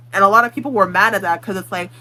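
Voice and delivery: ordinary delivery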